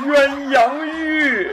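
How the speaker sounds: high, very slow sugary drawl; coy teasing. Pretend. Partner joins the flirting, high and drawn out. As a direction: stretch it out like a shy bride.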